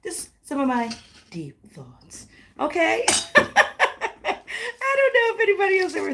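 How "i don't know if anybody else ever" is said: high, fast, through laughter; laughing. Real, not acted. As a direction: laugh at your own weird idea. Laughs at her own thoughts.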